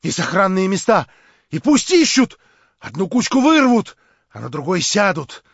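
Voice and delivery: high, loud feverish rant; frenzied tirade. A narrator's reading. Verkhovensky raving at Stavrogin in a frenzy.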